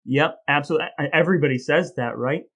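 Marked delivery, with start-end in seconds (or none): none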